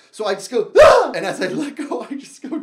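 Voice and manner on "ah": very loud high scream; loud high falling scream. Retold or imitated. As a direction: scream as you drop off. Letting go of the chair.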